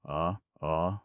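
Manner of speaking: ordinary delivery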